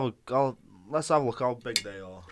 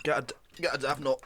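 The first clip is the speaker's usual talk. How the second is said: normal talking voice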